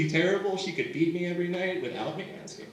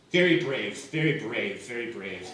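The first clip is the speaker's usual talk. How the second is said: dry sarcasm, fading; 8 semitones lower than usual. Retold or imitated. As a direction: let each very brave sink lower and trail away. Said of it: "She was just like".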